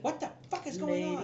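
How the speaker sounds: high-pitched woman's voice; startled brothel lady. Acted. Game master voices lady hearing commotion, high alarmed voice. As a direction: demand it high, put-on as the lady. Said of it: "the lady that was in the front".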